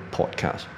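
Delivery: "podcast" with a rounded British short "o"; British-leaning pronunciation. Shown as a demonstration. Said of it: "这个是比较偏向英式的". (偏英式发音)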